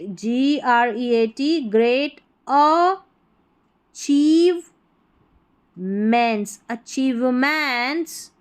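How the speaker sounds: achievements said slowly, syllable by syllable; broken into parts. Shown as a demonstration. Teacher sounding out achievements slowly for students. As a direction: stretch out achievements piece by piece. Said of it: "देखिए अ हो गया".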